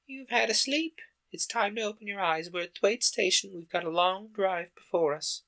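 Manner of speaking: put-on a brisk older servant waking a child; Miss Medlock voice. Medlock wakes Mary on train.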